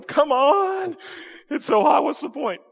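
high-pitched whiny groan; whining. Pretend. Mower complaining about heat, high whine. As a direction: moan like the lawn is the Sahara.

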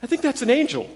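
high, wondering whisper-like voices; women at the tomb. Retold or imitated. Pastor voicing the women at the tomb, high and wondering. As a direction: voice the women nudging each other, lightly. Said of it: "they're nudging each other going".

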